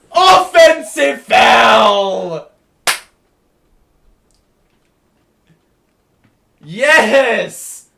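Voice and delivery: loud, drawn-out roar of joy; real jubilant yell; triumphant shout. Real, not acted. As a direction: bellow it as the call goes your way. Game-sealing call celebration.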